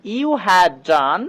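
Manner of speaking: very slow, deliberate and clear; slow model sentence. Shown as a demonstration. Teacher slowly modelling the sentence for students. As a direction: stretch each word for learners. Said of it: "তো এটাকে ইংরেজিতে বলবো".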